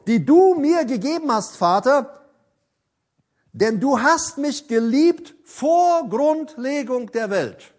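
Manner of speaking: loud, slow, soaring scripture proclamation; impassioned preaching. Acted. Preacher reads Jesus' prayer to the church, loud and slow. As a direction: build it to a climax and hold.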